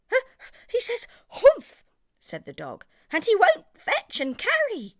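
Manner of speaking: very high, loud dog voice; dog reporting camel to din; dog tattling. Acted. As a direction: yap the complaint out high.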